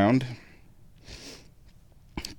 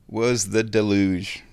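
normal talking voice

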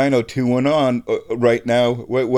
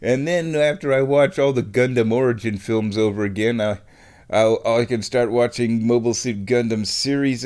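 ordinary delivery

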